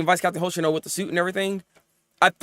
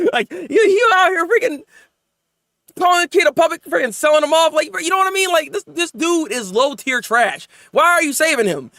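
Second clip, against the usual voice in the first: worked-up rant; 7 semitones higher than usual. Real, not acted. Reviewer venting about the trashy villain, exasperated. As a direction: vent at the show, half laughing. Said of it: "ranting about the villain".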